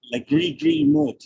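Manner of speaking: slow spoken-word poem; poem reading. Acted. Reads his short poem.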